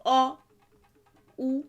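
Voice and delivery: each vowel called out high and loud with pauses; vowel drill. Shown as a demonstration. Teacher reciting the seven vowels for learners to repeat. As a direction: call out each vowel clearly for the class.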